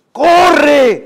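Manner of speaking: loud high yell of Corre; shouted order. Acted. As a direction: scream at him to run. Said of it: "le dice a su hijo".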